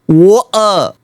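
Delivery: "Water" in British accent, T dropped. Shown as a demonstration. User corrects the AI, British accent on "Water". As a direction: glottal stop mid "Water", British style. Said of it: "how British people say it".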